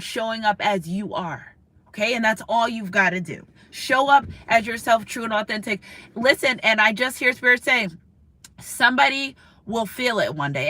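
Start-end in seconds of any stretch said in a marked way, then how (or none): none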